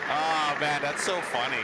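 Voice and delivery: ordinary talk, nothing marked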